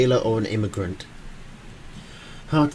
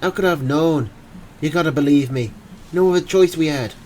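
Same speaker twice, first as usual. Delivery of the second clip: desperate Irish plea, rising higher and louder; Irish accent; 4 semitones higher, 4 dB louder than usual. Acted. Frantic Irishman begging the investigators to believe him. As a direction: beg in a thick Irish accent. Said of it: "in a thick Irish accent".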